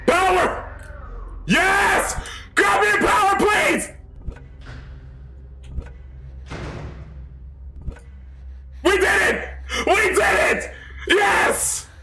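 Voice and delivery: very high-pitched victory screams; ecstatic screaming. Real, not acted. Screaming at winning the game.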